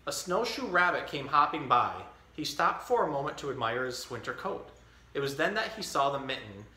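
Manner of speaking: put-on reading a picture book to a baby; story reading. Dad reading The Mitten to his baby, storytelling. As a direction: read it like story time.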